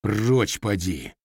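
slow growled command. A narrator's reading. Lad shoves the scoundrel away with a slow growl. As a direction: bark him off like a beast. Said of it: "рыкнул Матвей".

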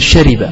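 "شرب" said with a kasra on the ra, shariba; correct pronunciation. Shown as a demonstration. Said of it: "بكسر الراء".

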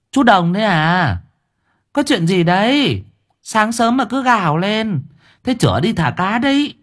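a hint of a fussing old aunt; old woman. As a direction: ask with mild surprise as an older woman. Old woman voiced in story.

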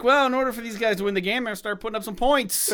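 high, loud mock sportscaster voice; football announcer. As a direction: ham up a TV game commentator. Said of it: "you sound like a football announcer".

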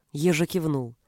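plain reading voice

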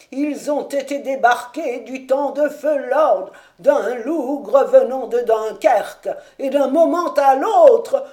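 high, loud anxious warning cry; urgent warning. Acted. Servant Caleb warns the lords of gunpowder, loud alarmed calls. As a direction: shout them away from the tower.

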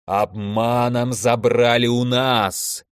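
slow monotone monster drone; stretched-out giant's grievance; accusing elemental. A narrator's reading. Elder elemental accusing Alexey of theft, slow monstrous drone.

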